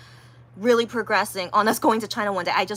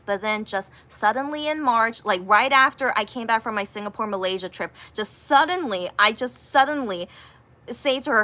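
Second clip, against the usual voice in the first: ordinary talk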